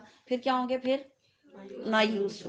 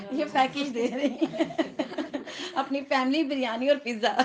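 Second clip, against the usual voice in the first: higher voice, talking through real laughter; laughing; 4 semitones higher than usual. Real, not acted. Teacher repeating a student's family biryani and pizza wish, laughing. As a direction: get the words out while laughing.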